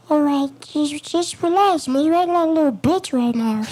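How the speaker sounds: very high coaxing inner voice; high calming voice. Retold or imitated. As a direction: soothe yourself in a squeaky high voice. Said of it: "this is my voice that I use to calm myself down".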